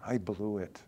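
a believer quietly regretting with a sigh; regretful. Retold or imitated. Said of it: "go home and weep".

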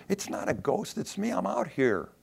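high, light call of reassurance; urgent reassurance. Acted. Jesus calling to his frightened disciples, urgent reassurance. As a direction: call out to identify yourself.

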